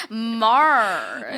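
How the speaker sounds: high, drawn-out name with a rise; mock reality-star lilt; Kristen Cavallari. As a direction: stretch the name in a put-on celebrity voice. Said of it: "Kristen Cavallari".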